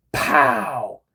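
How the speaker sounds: loud, explosive "pow"; imitated smack sound.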